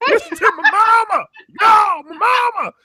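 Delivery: high, loud hammed-up wailing; shrill fake-crying plea for her mama; mock performative shouting. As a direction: overact a protest for show. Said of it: "Livy's performing like".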